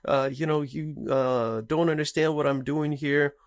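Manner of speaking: high-pitched imitation of an indignant kid; protesting daughter. Daughter arguing with parents over her phone, mock protesting. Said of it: "huge fight because she's like".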